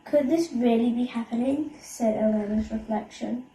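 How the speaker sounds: usual speaking voice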